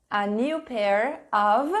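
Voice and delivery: "a new pair of" stretched out slowly with a rise; slow model prompt to fill in. Shown as a demonstration. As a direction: dictate it slowly to the class. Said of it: "write a sentence starting with".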